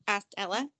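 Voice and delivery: plain reading voice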